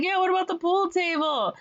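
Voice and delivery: high-pitched crowd echoing eagerly; mock crowd agreement. Townspeople echo the salesman about the pool table, mock excitement. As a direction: play a riled-up townsfolk chorus.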